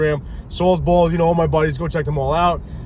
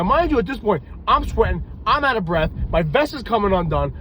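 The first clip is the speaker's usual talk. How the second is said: ordinary talk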